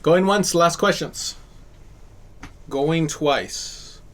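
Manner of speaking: calling out like an auctioneer; going once, going twice, auction style. Pretend. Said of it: "You sound like an auctioneer".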